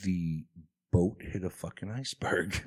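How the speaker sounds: low, quiet ghostly voice with effect; otherworldly voice. Pretend. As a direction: speak from beyond, low and hushed. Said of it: "an otherworldly voice".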